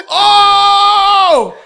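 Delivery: long, high, loud "oh"; starstruck scream. Retold or imitated. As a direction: scream in hammed-up awe. Awestruck at seeing Denzel.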